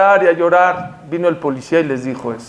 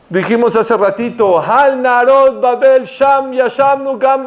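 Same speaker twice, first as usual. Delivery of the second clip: raised, emphatic sermon delivery; impassioned preaching; 6 semitones higher, slower than usual. Acted. Preacher reminding the congregation of a verse, impassioned. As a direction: preach it out, pitched high and drawn out on the verse.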